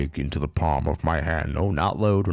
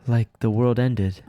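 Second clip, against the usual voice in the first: Kurisu; 4 semitones higher than usual. Acted. Voices Kurisu in the game.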